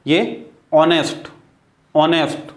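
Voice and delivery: ऑनेस्ट said starting on the vowel, no h; silent h in honest. Shown as a demonstration. Teacher modelling honest for students, ऑनेस्ट with no h. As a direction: open ऑनेस्ट straight on aw, drop the h. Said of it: "यहाँ एच का sound नहीं होगा".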